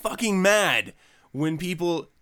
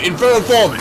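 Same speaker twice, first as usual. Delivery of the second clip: segment announcer; 6 semitones higher, 14 dB louder, faster than usual. Acted. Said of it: "let's move on to the Infernal Informant".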